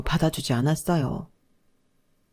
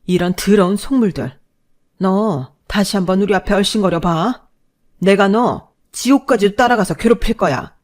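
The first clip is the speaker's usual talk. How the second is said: rising, louder furious threat; angry outburst; 3 semitones higher, 6 dB louder than usual. Acted. Mother furiously threatening the groveling husband. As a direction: spit the threat at him.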